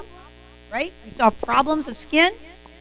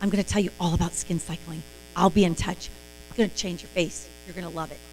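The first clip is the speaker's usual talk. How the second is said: secretive hush; 6 semitones lower, 9 dB quieter than usual. Shown as a demonstration. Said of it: "And you go".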